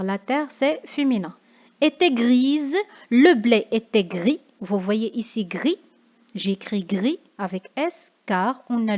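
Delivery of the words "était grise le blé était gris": louder, stressing "Était" and "Le blé"; emphatic reading. Shown as a demonstration. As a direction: read the poem line out firmly to the class. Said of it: "Car on a la terre". (lecture d'exemple appuyée et forte)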